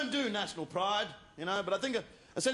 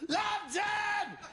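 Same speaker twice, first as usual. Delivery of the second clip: high, laughing triumphant yell; jubilant shout; 9 semitones higher, much slower than usual. Retold or imitated. Driving through the East End, yelling with pride. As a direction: roar it out the car window.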